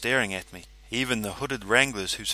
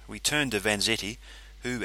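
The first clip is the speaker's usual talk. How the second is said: ordinary delivery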